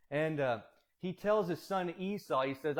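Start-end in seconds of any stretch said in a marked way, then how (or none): none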